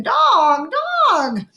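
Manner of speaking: high, loud parrot squawks; screaming parrot. A narrator's reading. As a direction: screech it like a frantic bird. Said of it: "Gertrude kept screaming".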